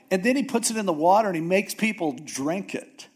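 ordinary talk

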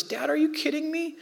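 put-on high, pleading voice of a tearful twelve-year-old girl; imitating his daughter, high and distressed; upset daughter. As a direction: plead like a hurt kid who can't believe it. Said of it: "And she goes".

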